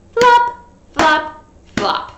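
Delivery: high, loud "Flop, flop"; flop sound effect. A narrator's reading. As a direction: bounce the flops.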